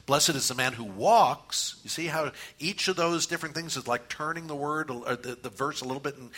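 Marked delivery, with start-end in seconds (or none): 0.1-1.8 "walks" punched loud and long; stress on WALKS. Shown as a demonstration. Preacher demonstrates meditating word by word, stress on "walks". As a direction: run up to "walks" and hit it hard. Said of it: "just emphasize the word as you go".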